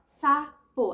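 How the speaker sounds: sapo split as SA... PO, a clear gap between; syllable by syllable. Shown as a demonstration. Teacher showing a child how to count syllables, sapo broken syllable by syllable. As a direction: say "sapo" in two slow, separate syllables. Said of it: "quantas vezes eu abro minha boquinha".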